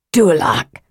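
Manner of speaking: a touch of a gasp; lightly gasped incantation; gasped word. A narrator's reading. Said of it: "Raistlin gasped".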